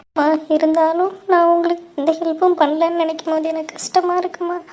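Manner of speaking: high, tearful voice; crying. Acted. Daughter sorry she could not help her mother, crying. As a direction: speak through tears, pitched high. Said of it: "முதல்ல அழுகாதீங்க".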